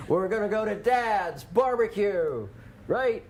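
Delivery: high, slow, loud Southern drawl; Southern accent. Pretend. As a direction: stretch it out in a big Southern accent. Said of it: "hear that Southern accent for Dad's Barbecue".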